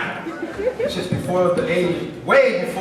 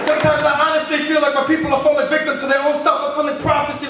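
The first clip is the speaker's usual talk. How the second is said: driving slam poem delivery, higher and louder than chat; spoken word poetry; 11 semitones higher, 4 dB louder than usual. Acted. Poet to the audience about the children of the wind, rhythmic slam delivery.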